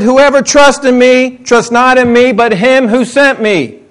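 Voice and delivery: usual speaking voice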